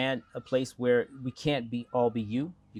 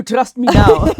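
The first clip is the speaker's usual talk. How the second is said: put-on a much higher, louder South African accent dissolving into laughter; South African accent, laughing; 19 dB louder than usual. Candidate teases the interviewer in a South African accent, laughing. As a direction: push the South African accent up high, then crack up. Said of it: "I will answer all my questions like this".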